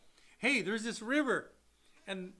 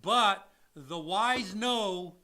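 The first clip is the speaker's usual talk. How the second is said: very slow, weighty delivery; slow and solemn wisdom; slow moral; 6 dB louder, slower than usual. Real, not acted. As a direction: deliver it like a proverb. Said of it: "slow moral pronouncement".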